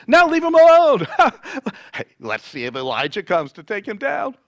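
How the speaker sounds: loud, high burst with a scoffing laugh; jeering taunt. A narrator's reading. Man offering the sponge taunts dying Jesus, jeering. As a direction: shout the taunt, laugh, then ease off.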